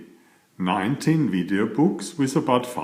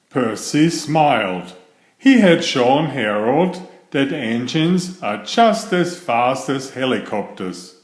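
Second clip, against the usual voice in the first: usual speaking voice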